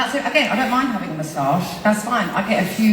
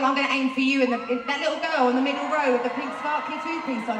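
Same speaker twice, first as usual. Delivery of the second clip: ordinary talk, nothing marked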